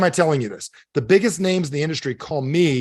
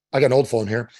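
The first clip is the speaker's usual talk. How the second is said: ordinary talk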